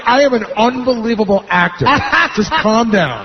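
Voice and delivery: lightly mocking a boastful actor; a hint of a smug drunk bragging; cocky actor. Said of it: "you said to me".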